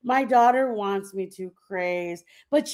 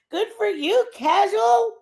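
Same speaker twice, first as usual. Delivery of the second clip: higher, louder sarcastic cheer; mock cheer; 12 semitones higher, 7 dB louder than usual. Real, not acted. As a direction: toss off a loud ironic congratulation. Mock congratulation.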